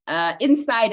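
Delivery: normal talking voice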